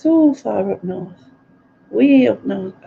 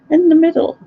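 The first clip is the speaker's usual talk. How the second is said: higher and louder, stressing In the middle; emphatic echo; 10 semitones higher, 6 dB louder, faster than usual. Real, not acted. Agreeing with a viewer, an emphatic high repeat.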